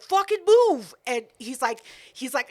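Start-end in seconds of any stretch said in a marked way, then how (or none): 0.0-0.9 higher and louder, irritated; angry snap. Retold or imitated. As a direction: snap at her to get out of the way.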